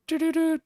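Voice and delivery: high little sung-out doo-doo-doo. Shown as a demonstration. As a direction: sound out a little shape in high toots. Imitates little shape of Oklahoma.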